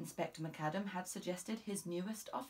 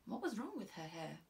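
usual speaking voice

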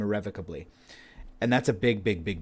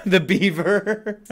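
laughing; 10 semitones higher, 8 dB louder, much slower than usual. Pretend. Laughing into character.

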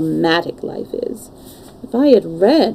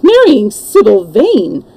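slow, louder astonished cry; perplexed amazement; 2 semitones higher, 6 dB louder, slower than usual. A narrator's reading. Lord Henry to Dorian on hearing of the marriage, amazed. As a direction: cry it out in disbelief. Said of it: "in a perplexed amazement".

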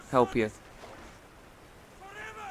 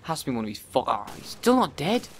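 ordinary delivery

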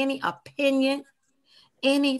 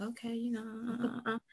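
hushed; 11 dB quieter than usual. Retold or imitated. Sitting hidden, hesitant.

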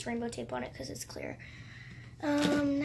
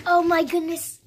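loud, higher burst of excitement; delighted surprise; 5 semitones higher, 12 dB louder than usual. Real, not acted. Vlogger finding a slime she forgot, delighted surprise. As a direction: exclaim over a happy rediscovery.

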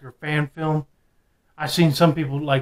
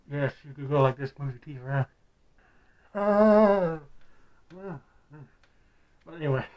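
muffled behind full face mask; through a latex mask; 5 dB louder than usual. Real, not acted. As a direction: mouth covered by rubber mask. Seen 4: Speaker wears full latex mask over face throughout; unmasked in refs.

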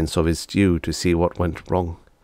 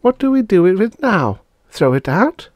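put-on little girl Bonnie voice; 13 semitones higher, 5 dB louder than usual.

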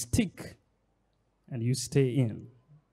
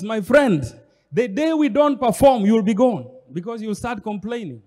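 ordinary talk, nothing marked

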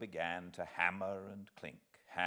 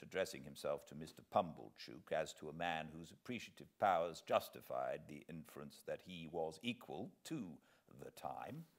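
ordinary delivery, nothing marked

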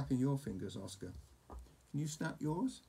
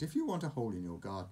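usual speaking voice

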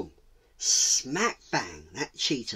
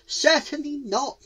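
loud, high owl voice; raised, high-pitched complaint; indignant owl; 13 semitones higher, 4 dB louder than usual. Acted. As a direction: demand a volunteer from the crowd. Owl objects.

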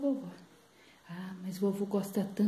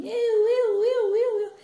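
put-on loud, high wavering child's cry; singsong, insistent high yelling; clamoring grandchild; 11 semitones higher, 8 dB louder, slower than usual. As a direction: beg shrilly to be picked. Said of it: "Netinho só ficava falando assim".

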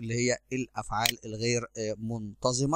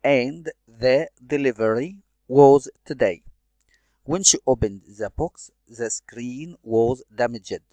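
measured dictation pace, louder; slow, clear read-aloud; 9 dB louder, much slower than usual. Shown as a demonstration. Teacher reading the listening text to students, slow and loud. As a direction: read the passage slowly and loudly for the class.

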